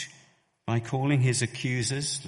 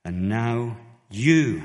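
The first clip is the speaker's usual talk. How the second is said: "you" stressed hard and louder, drawn out slow; emphatic you; 2 semitones higher, 8 dB louder, slower than usual. Shown as a demonstration. Stephen accusing the elders, "you" slow and emphatic. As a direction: lean on "you" slowly. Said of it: "it's an emphatic you".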